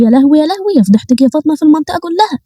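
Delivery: hammed-up Egyptian lament, rising; wailing mother.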